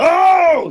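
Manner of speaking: high, loud mock shriek; sudden high scream in imitation; imitated child's scream. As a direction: shriek like a child dreading the needle. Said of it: "these kids that start screaming".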